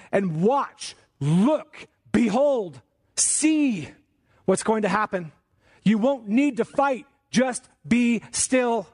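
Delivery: high, slow, drawn-out exhortation; impassioned preaching. Real, not acted. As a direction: urge the congregation, word by weighted word. Preaches the command at raised pitch.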